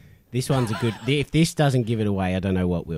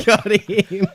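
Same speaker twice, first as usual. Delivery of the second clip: laughing gleefully, pitched up; 8 semitones higher, 3 dB louder than usual. Real, not acted. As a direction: crack up as you gloat. Gloats while laughing.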